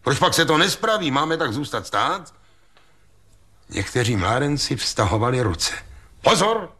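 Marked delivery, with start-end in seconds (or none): 0.0-2.3 higher, quicker impatient questions; voiced annoyed character; indignant demand. A narrator's reading. Ostrohradský indignantly demanding action in story. 6.2-6.7 high, sudden cry of warning; startling high call for attention; resounding cry. A narrator's reading. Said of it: "vzkřikl malý Heribert hlasem k neuvěření širokým a zvučným".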